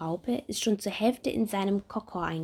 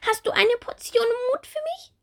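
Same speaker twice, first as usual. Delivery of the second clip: loud, high excited call; excited little bumblebee; 6 dB louder than usual. Acted. Bumblebee Bommel rushing in to the doctor, high excited call. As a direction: burst in eagerly. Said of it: "sagt Bommel aufgeregt".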